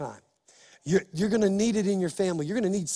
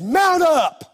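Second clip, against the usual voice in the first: rallying shout; 9 semitones higher, 7 dB louder than usual. Retold or imitated. David rallies his men to ride, loud high shout.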